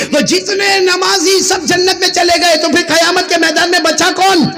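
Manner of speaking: rising pulpit fervour; fiery preaching. Acted. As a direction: preach it out to the whole gathering. Preacher builds to question.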